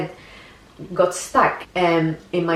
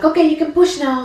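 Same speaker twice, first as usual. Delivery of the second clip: nurse's cue; 9 semitones higher, 5 dB louder than usual. Retold or imitated. Nurse tells her to push.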